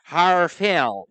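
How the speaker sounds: exaggerated American accent. Joining the teasing about American speech, exaggerated American accent. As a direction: belt out the American vowels. Playing along with accent mockery.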